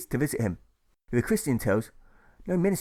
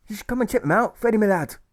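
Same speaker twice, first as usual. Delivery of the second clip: higher, louder Irish soldier; higher, louder Irish brogue, coaxing a mate to the brothels; Irish accent; 10 semitones higher, 6 dB louder than usual. A narrator's reading. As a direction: lure Freddy along in a cheeky Irish voice. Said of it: "the sympathetic soldier says".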